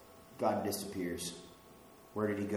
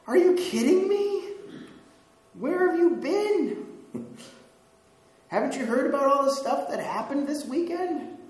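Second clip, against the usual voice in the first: much higher and louder, disbelieving; incredulous disciples; 13 semitones higher, 7 dB louder than usual. Retold or imitated. Disciples on the road scoffing at the stranger, incredulous and loud. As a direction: throw it back at him like he's clueless. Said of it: "and they're like".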